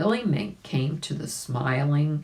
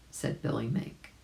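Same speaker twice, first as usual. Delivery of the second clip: plain reading voice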